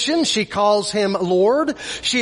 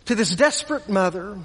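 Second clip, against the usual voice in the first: usual speaking voice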